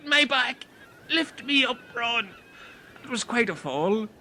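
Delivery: ordinary talk, nothing marked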